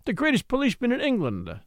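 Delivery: stern rebuke, pitched high. A narrator's reading. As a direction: say it like a finger-wagging reproof. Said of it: "shaking his forefinger at me sternly".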